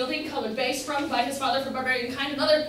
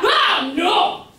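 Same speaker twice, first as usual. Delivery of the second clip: sudden loud, higher cry of refusal; loud protest; 6 semitones higher, 10 dB louder than usual. Acted. Lover shouting down the threat of a constable, loud. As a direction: blurt it out loud, panicked.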